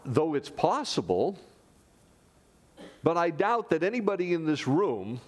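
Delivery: ordinary talk, nothing marked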